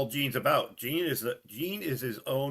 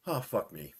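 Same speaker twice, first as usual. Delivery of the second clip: fast exasperated aside; mock grumble; 4 semitones lower than usual. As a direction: grumble it like a weary celebrity. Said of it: "in his head, he's going".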